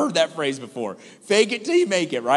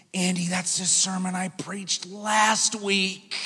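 stunned pastor; 6 dB quieter, slower than usual. Retold or imitated. Joel, gone pale, tells Andy quietly and slowly. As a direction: let it fall flat and slow in shock.

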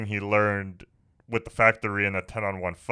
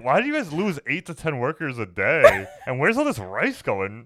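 mock boss voice; 10 semitones higher, 5 dB louder than usual. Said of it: "He's like".

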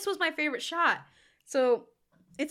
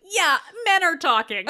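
higher, louder laughing brush-off; mock dismissive; 5 semitones higher, 10 dB louder than usual. Mocking the men ignoring the woman with a loud, laughing brush-off. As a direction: wave her off like the men in the scene.

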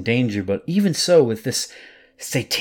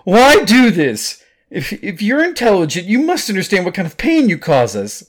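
desperate outburst; 11 semitones higher, 8 dB louder than usual. Acted. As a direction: demand answers from the monster. Confronting the creature.

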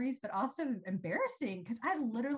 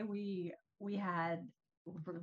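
normal talking voice

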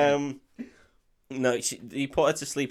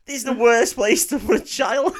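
incredulous; 8 semitones higher, 6 dB louder than usual. Real, not acted. Loud incredulous laugh.

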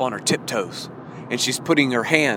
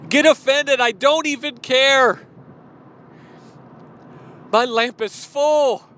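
defiant preaching; 9 semitones higher than usual. Real, not acted. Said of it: "that's what I'm saying".

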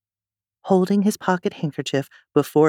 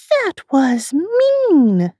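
much higher, louder, very slow indignation; indignant; 13 semitones higher, 6 dB louder, much slower than usual. Acted. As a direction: protest like an offended little girl. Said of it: "Alice said indignantly".

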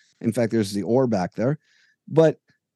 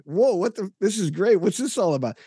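high, amazed reaction; surprised delight; 7 semitones higher than usual. Retold or imitated. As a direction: act out being caught off guard by a gift. Surprised at the gift jersey.

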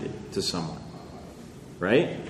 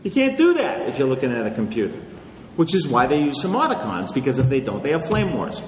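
usual speaking voice

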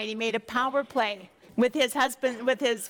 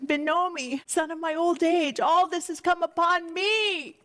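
higher-pitched, acted father's grief; anguished lament; 6 semitones higher than usual. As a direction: plead like a bereaved old father. Jacob laments his lost children.

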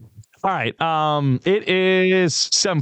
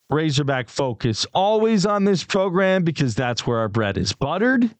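ordinary talk

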